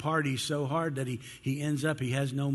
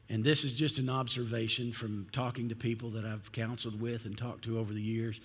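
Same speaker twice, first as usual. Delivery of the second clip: ordinary talk, nothing marked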